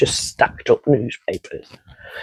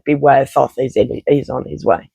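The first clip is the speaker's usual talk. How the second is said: normal talking voice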